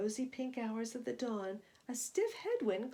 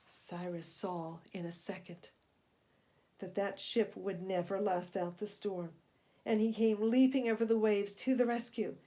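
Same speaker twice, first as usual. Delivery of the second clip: plain reading voice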